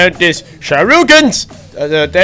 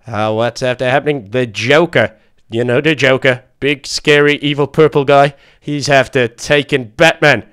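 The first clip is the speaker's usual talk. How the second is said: lower, odd butler voice bringing grave news; Alfred the butler; 3 semitones lower than usual. Acted. Butler telling Baby Duck about the emergency, low worried voice.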